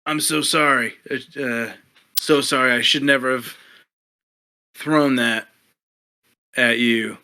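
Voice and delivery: mock-contrite fighter, theatrically loud; hammed-up apology. Fighter faking remorse to the inspector, loud and hammy. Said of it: "he'll say".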